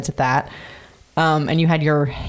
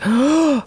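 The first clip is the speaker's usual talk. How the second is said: a sudden high gasp of shock; retold shocked gasp; sharp gasp; 10 semitones higher, 3 dB louder than usual. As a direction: gasp high and loud at the test. Seeing the pregnancy test.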